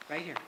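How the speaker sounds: sharp breath in, caught off guard; startled gasp. Shown as a demonstration. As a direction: gasp like your dad just surprised you in the dark. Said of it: "Will you do that for me?".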